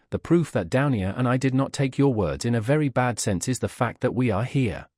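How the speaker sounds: ordinary delivery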